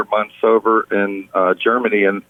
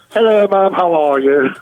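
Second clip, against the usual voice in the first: a light put-on British accent, pitched higher; mock British accent; 7 semitones higher than usual. Son calling his mother, in a mock British accent. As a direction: greet mom with a borrowed British lilt. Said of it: "all of a sudden my voice starts changing".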